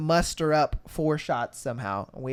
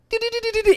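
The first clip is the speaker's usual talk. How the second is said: scurrying noise; much faster than usual. Retold or imitated. Cockroach darting off.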